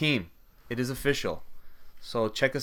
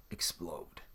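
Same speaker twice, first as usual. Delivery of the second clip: breathy ominous whisper; dramatic whisper; 5 semitones lower, 13 dB quieter than usual. Pretend. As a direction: whisper it like a villain. Omniverse will explode, whisper.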